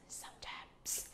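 "Sometimes" whispered, breath only. Shown as a demonstration. Teacher whispers "Sometimes" back to the students. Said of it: "nhớ phát âm cuối".

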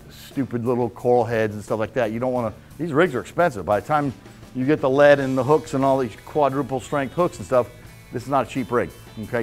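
ordinary talk, nothing marked

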